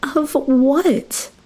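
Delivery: high desperate cry. A narrator's reading. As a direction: cry out in panic. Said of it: "I cried".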